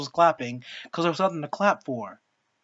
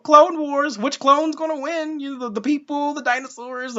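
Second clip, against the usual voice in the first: mock hype; 8 semitones higher than usual. Mock hype of clone contest.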